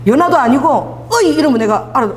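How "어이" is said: blunt Busan-dialect hail. Acted. Father calls his child in an urgent moment, Busan dialect. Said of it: "부산 사투리 썼어요".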